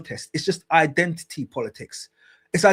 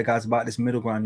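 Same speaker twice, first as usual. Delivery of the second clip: ordinary talk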